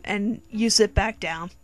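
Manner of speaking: ordinary delivery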